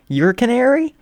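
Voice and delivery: high, disbelieving question; astonished. Acted. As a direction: squeal it out in shock. Midnight recognizing the shy singer.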